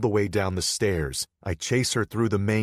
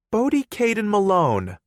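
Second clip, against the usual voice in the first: put-on higher pitched playful girlfriend; young woman voice; 9 dB louder than usual. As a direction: voice her higher, coaxing. Girlfriend scolding with full name.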